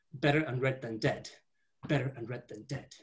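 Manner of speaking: ordinary talk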